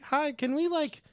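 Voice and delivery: imitating an eager door-knocking kid. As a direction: pitch it up like a kid asking for work. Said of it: "a kid knocked on the door".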